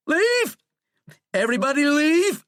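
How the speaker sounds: very high, loud scream; furious scream. A narrator's reading. President ordering everyone out, screaming in fury. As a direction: scream them out of the room. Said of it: "screamed in unbridled fury".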